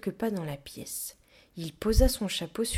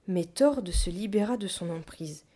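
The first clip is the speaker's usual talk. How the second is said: usual speaking voice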